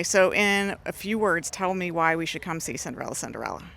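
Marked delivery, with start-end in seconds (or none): 3.1-3.6 deep echo. Shown as a demonstration. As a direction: drop low like an echo. Host echoes title lower.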